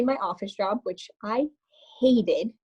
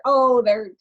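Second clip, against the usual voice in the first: ordinary talk, nothing marked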